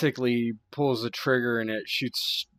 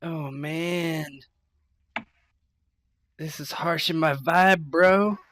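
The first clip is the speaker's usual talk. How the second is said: high-pitched complaint, getting louder; dismayed character voice; 6 semitones higher, 4 dB louder, slower than usual. Acted. Player's character seeing Gimps come at them, high dismayed gripe.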